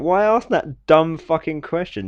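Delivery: high, loud exasperated outburst; exasperated rant. Acted. Viewer berating interviewers over their question, exasperated. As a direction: rant in disbelief at a stupid question.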